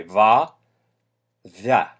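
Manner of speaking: va said soft, lips pursed. Shown as a demonstration. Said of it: "soft V".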